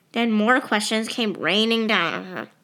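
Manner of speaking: ordinary delivery, nothing marked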